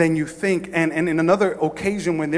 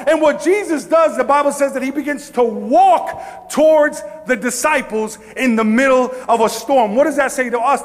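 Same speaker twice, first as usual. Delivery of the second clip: high, forceful sermon delivery; fired-up preaching; 7 semitones higher, 5 dB louder than usual. Acted. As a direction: preach it with rising fervor.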